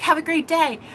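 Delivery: a touch of hearty friendliness; imitated friendly send-off. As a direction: repeat his cheerful goodbye. Repeating his cheerful send-off.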